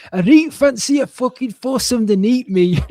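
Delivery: high, loud Geordie comic voice; broad Newcastle lad reading a caption; Geordie accent. Retold or imitated. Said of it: "I'm gonna try and do a Geordie accent".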